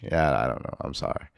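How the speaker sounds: normal talking voice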